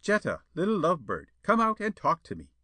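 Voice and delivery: high, pleading call up to the window; coaxing suitor call. A narrator's reading. Suitor calling Jetta out to the garden, pleading. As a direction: coax her to come out.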